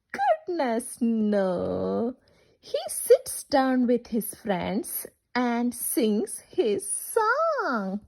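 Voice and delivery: ordinary talk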